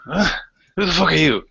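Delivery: sudden high rough challenge; startled chieftain. Acted. Chieftain sees strangers in his hall, startled and rough. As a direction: bark it at the intruders.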